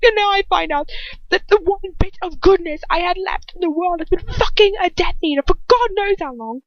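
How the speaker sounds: high, loud, anguished shout; furious outburst. A narrator's reading. Ron raging at Hermione over her betrayal, anguished shout. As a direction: hurl it at her, heartbroken.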